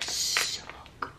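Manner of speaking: soft breathy whisper. Real, not acted. Repeats praise, second whispered.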